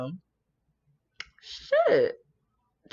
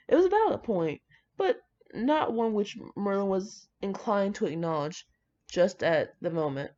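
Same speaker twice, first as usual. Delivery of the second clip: just read the story out steadily; story narration; 4 dB quieter than usual. Acted. Reads fan fiction aloud.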